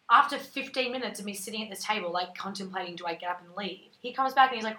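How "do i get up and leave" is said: ordinary talk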